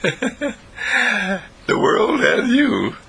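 high, laughing through the words; slow, helplessly amused delivery with the pitch jumping up. Real, not acted. Teacher amused by the world and you, laughing.

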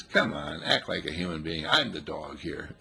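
a hint of a patient dog; lightly voiced dog. Dog answering its owner, low and unhurried. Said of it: "And your dog says".

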